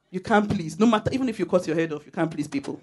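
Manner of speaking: ordinary talk, nothing marked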